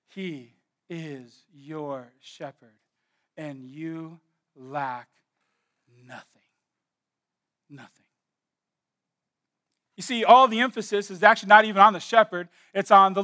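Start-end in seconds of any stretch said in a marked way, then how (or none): 0.1-8.0 slow, hushed assurance. Real, not acted. Slow intimate emphasis to congregation.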